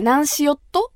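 light Kumamoto local question; Kumamoto dialect. Shown as a demonstration. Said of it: "この柔らかさがなくなって".

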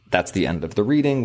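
ordinary delivery

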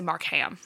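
Markham with the h sounded, "Mark-ham"; pronounced Mark-HAM. Shown as a demonstration. Podcaster settling on a pronunciation, Markham said as "Mark-ham". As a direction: give Markham a full "ham" ending. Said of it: "I'm gonna say".

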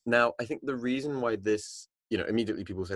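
normal talking voice

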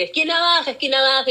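high, loud calling out of a bus conductor; mock combi caller yelling the stop; bus caller shout. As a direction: call the stop like a combi fare collector. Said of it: "tú estabas así como que".

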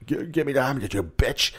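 imitated vampire threat, held back; Dracula. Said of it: "he's like".

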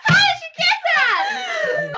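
high, astonished cry; amazed. Real, not acted. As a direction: shriek in disbelief. Amazed at charades guess.

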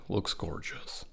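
soft unvoiced whisper. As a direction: whisper it gently. Whispering description.

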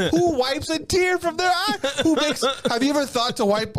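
high-pitched outburst of disbelief; astonished rhetorical question; incredulous. Real, not acted. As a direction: exclaim it in amazed disbelief, voice way up. Disbelief at his own story.

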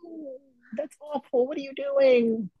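high slow whimper of complaint; patient whining as the nurse stops the water; high, slow whine. Retold or imitated. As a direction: plead like a denied child.

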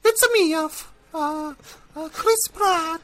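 fat Italian plumber voice. Acted. Actor doing a voice audition for his agent, squeaky plumber voice. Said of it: "Can you do a fat Italian plumber voice?".

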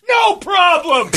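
high, loud, slow nervous yell; awkward shout. Retold or imitated. Fan answering naked Mark Grace, high slow awkward shout. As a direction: blurt it out way too loud. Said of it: "I shouted".

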